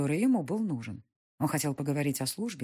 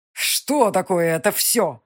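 медленно, с закипающим гневом, громче; сдерживаемая ярость; вскипел; 4 semitones higher, 9 dB louder, much slower than usual. Acted. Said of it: "вскипел молодой Ильинский". (гневно, медленно)

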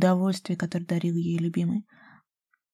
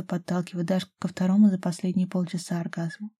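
plain reading voice

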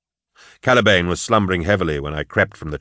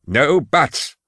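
higher, curt bark; 7 semitones higher than usual. A narrator's reading. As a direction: cut in with a sharp reprimand. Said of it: "barked Jardone".

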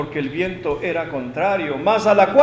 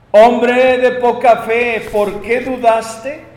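slow, drawn-out reproach, opening high and loud; 3 semitones higher, 7 dB louder, slower than usual. Acted. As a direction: challenge the doubter, stretching each word. Voices Jesus reproaching Peter for doubt. (reproche solemne, fuerte y lento)

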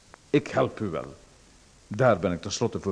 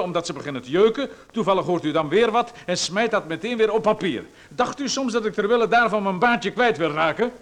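higher, sharp indignant tirade; indignant outburst; 7 semitones higher than usual. Acted. Visitor refuses the writer's plan, high and indignant. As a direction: scoff at the idea and refuse, outraged. Visitor outraged at the cotton-wool idea.

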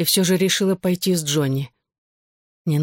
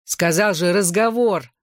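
mildly annoyed, emphatic retort; irritated; slower than usual. A narrator's reading. Johnny snaps at friends interrupting his talk, mildly irritated. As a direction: brush them off with a touch of irritation. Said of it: "раздражённо бросил Джонни".

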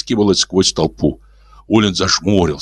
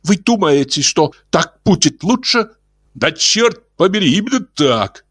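heated earthling; 11 semitones higher than usual. Acted. Neighbor voiced.

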